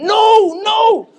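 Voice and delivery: high, loud shouted no; group shout. Retold or imitated. Americans shrieking against calling the ambulance. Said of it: "all the Americans went".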